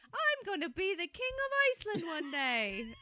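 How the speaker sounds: put-on high, loud little boy voice; kid voice. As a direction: play a bossy little kid dreaming big. Kids quoted, child voice.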